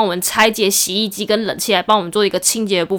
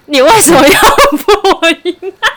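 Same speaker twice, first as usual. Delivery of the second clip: laughing; 8 semitones higher, 8 dB louder than usual. Real, not acted.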